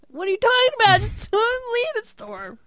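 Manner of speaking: very high, exasperated disbelief; incredulous. Retold or imitated. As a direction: squeak it in amused disbelief. Mocking the clerk's absurd advice.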